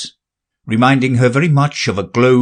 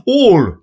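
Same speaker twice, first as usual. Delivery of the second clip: All stressed high and louder; great emphasis; 10 semitones higher, 5 dB louder than usual. Acted. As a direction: hit the word All hard. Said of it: "he repeated with great emphasis".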